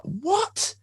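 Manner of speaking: very high, mock-startled squeak; rising squeal of disbelief. Reacting to a stranger calling him over, startled squeak.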